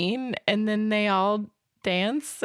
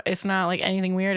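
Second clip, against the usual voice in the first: ordinary talk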